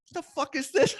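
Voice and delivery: high-pitched mock disbelief; incredulous. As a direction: retell the disbelief in a high, exaggerated voice. Retelling shock at rough guests.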